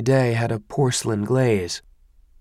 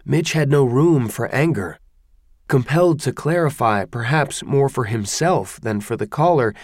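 plain reading voice

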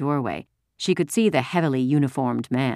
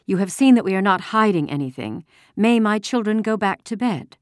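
firm, higher-pitched mother; strong voice; 7 semitones higher, 4 dB louder than usual. A narrator's reading. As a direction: assert it politely but without backing down. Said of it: "Mama said in a strong voice".

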